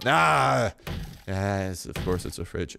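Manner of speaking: normal talking voice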